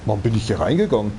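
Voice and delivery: normal talking voice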